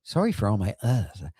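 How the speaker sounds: ordinary talk, nothing marked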